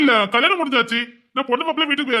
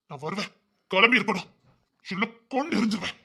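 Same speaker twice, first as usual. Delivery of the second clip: hushed, much softer menace; very quiet warning; 8 dB quieter than usual. Acted. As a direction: threaten under the breath. Threat after the shouting match.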